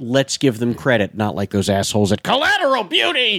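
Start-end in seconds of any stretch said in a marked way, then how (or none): 2.2-3.4 hammed-up scornful exclamation; mocking jab. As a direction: sneer the name out in a raised voice. Mocking jab at another film's makers.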